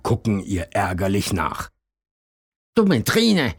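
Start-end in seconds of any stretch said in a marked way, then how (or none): 2.7-3.5 high, huffy muttered jab; high-voiced sulky insult; grumbling insult. A narrator's reading. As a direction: grumble it after her, high. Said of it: "sagt Hasen Tim".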